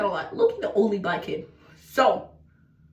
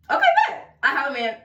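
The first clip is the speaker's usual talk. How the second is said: very high squeaky cheer; excited; 3 semitones higher, faster than usual. Retold or imitated. Reacting to getting back together with boyfriend, excited. Acting out delight at having boyfriend.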